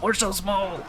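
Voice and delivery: put-on high squeaky dwarf with a Mexican accent; silly high Mexican-accented dwarf whine; mock Mexican accent. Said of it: "I just sounded so Mexican there".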